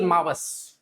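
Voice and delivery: मावस with the opening a dropped; मावस, slow and drawn out; dropped first vowel. Shown as a demonstration. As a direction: say मावस, the a of अमावस gone. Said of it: "ए silent हो जाता है".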